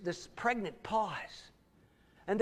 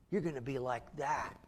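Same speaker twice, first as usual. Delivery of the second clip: softer, lower ominous repeat; low quiet warning; 7 semitones lower, 7 dB quieter than usual. Retold or imitated. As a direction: drop the voice for the final threat. Parent voiced threatening child.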